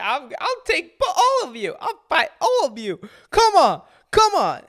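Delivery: loud, high, laughing mock challenge; mocking tough guy. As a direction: ham up a brawler daring everyone. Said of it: "Now he's literally like".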